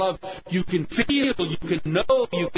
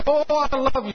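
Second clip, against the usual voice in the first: high, loud gushing French lover; hammed-up declaration of love, pitched up; Pierre; 11 semitones higher, 5 dB louder than usual. French husband assures his wife of his love, gushing. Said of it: "you know how the French can be".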